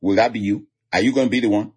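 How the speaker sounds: usual speaking voice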